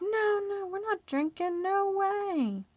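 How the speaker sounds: high-pitched imitation of protest; protesting denial. Couple protesting to the laughing man, insistent denial. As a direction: deny it insistently, voice way up. Couple denies being drunk.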